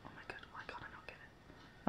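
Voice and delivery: hushed whisper so the pet stays; real whisper. Real, not acted. Whispering to herself as the pet comes to join. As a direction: breathe the words with no voice.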